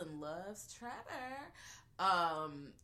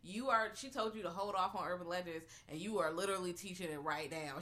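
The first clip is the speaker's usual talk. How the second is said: ordinary talk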